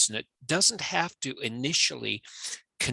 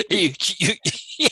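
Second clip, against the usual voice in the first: higher, louder words through laughter; laughing; 7 semitones higher, 4 dB louder, faster than usual. Real, not acted. Speaker amused by the co-host's point, laughing. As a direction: laugh while trying to get the words out. Laughs while agreeing with co-host.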